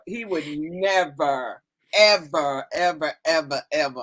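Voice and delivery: slow, low, each ever stressed; emphatic never ever. Real, not acted. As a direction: insist firmly on ever. Insisting husband never would.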